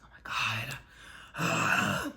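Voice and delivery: low, loud, drawn-out exasperation; exasperated retold reaction. Speaker reacting to having to repeat patois, exasperated. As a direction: groan it out slowly and low. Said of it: "And I'm just like".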